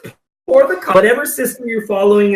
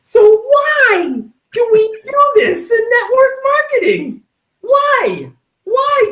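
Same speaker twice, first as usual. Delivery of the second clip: exasperated outcry; slower than usual. Acted. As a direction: throw the question up in mock despair. Mock-exasperated rhetorical question.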